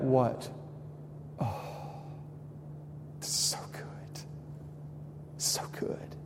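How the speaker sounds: breathy hushed whisper of delight; savoring whisper. Pretend. Preacher imitating a diner savoring steak, whispered. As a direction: whisper it like the best bite ever.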